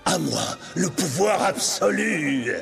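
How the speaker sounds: slow roaring villain cry; triumphant shout. A narrator's reading. New genie Jafar claims power, slow roaring shout. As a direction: bellow his victory.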